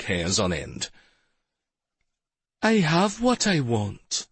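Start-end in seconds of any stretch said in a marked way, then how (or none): 2.6-4.3 high, slow, silky purr. A narrator's reading. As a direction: purr it out, savouring it. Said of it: "the voice answered in a silky purr".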